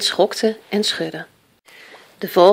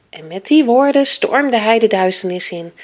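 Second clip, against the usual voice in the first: plain reading voice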